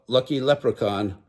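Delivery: usual speaking voice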